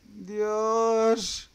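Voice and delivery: frightened high gasp of alarm; scared cry. Real, not acted. Scary moment in horror game.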